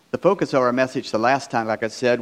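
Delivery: ordinary delivery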